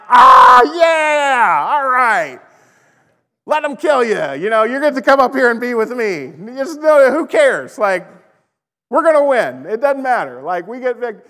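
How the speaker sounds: high, loud, overjoyed Jesus voice; a thrilled Jesus cheering them on; giddy Jesus. Retold or imitated. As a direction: play Jesus cheering from heaven. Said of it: "he's just like".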